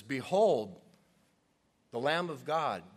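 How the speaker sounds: proclaiming. Acted. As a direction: point and announce him. Preacher re-enacts John pointing.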